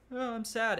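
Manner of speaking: high, hammed-up whimper of a sad father; lightly mock the dad's sorrow. Reviewer mocking Miles's dad's weak reaction, hammed-up sadness. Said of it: "and then his dad's like".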